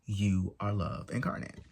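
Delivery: ordinary talk, nothing marked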